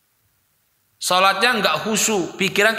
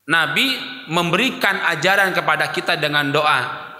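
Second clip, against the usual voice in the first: ordinary delivery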